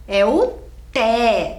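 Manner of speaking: slow "É o té" with "té" stretched out; pause, then hold "té" long and firm; stress on TÉ. Shown as a demonstration. Teacher giving pupils the strong syllable, "té" drawn out. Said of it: "a sílaba mais forte".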